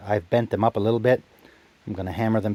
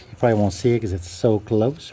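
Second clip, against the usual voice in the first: usual speaking voice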